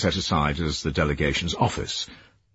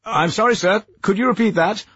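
shouting down the phone; 4 dB louder than usual. Acted.

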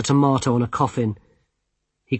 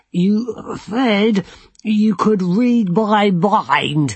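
strained wizard; 7 semitones higher, much slower than usual. A narrator's reading. Desperate challenge.

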